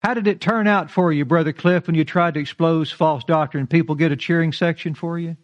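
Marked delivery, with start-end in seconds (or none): none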